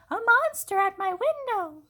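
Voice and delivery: high loud scared cry; frightened shout. A narrator's reading. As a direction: shriek at the monster. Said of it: "shouted Baby".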